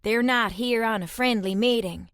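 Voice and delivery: usual speaking voice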